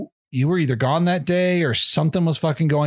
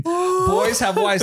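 very slow and much higher; slow mock emphasis; much slower than usual. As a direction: drag it out as settled fact. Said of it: "He goes".

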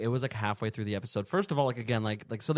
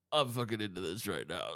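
stoned mumble; 6 semitones lower, 8 dB quieter than usual. Retold or imitated. Stoned self retold.

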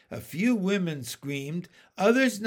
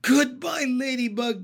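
gasped goodbye; 6 semitones higher, 4 dB louder than usual. Acted. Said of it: "gasped James".

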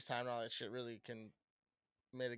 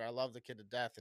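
ordinary talk, nothing marked

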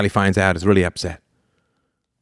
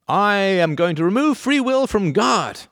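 slow, high, grand mock decree; mock pronouncement; 11 semitones higher, slower than usual. Mocking Spinoza's claim as a grand high decree. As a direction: announce it like a pompous edict. Mock God's own declaration.

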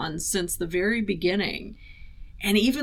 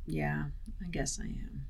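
much softer, almost whispered; hushed; 3 semitones lower, 12 dB quieter than usual. Retold or imitated. Lloyd answering Flagg, hushed and much softer. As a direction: drop right down to a near-whisper. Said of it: "Lloyd whispers".

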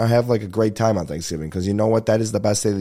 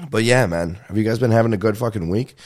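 usual speaking voice

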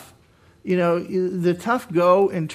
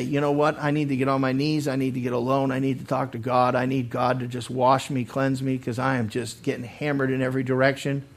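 softer, lower, earnest admission; humble confession; 5 semitones lower, 6 dB quieter than usual. Acted. Beaten-down believer resolving to talk to God, humble. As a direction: quietly admit the need, quick and low.

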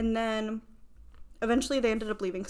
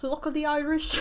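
mock Irish accent; 5 semitones higher, 4 dB louder than usual. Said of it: "I wanna do this".

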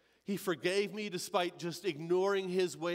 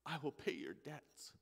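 low, soft, calm voice of God; Yahweh speaking gently; 4 semitones lower, 9 dB quieter than usual. Acted. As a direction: answer softly from above, unhurried. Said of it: "he spoke to me and just said".

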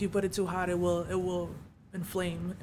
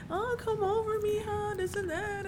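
much higher sweet mom voice; much higher imitation of a doting mom; 13 semitones higher than usual.

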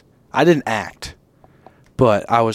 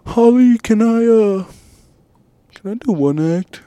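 higher pleading voice; 11 semitones higher, 3 dB louder than usual. Retold or imitated. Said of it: "And I was like".

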